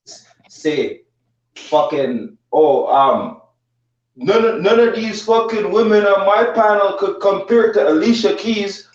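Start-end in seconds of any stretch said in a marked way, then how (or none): 2.5-8.9 lightly mocking, pitched higher; mocking quote. Retelling the panel host's [name] remark, mildly mocking and higher. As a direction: mimic him with a touch of scorn, higher.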